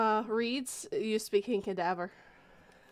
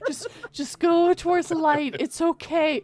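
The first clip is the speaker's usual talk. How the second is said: mock tender killer reassurance; creepy soothing voice; 4 semitones higher, 8 dB louder than usual. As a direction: soothe a victim toward the light.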